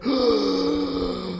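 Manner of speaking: long, noisy breath; exaggerated breathing. Podcaster repeating the oxygen gag, exaggerated breath. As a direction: breathe hard for comic effect.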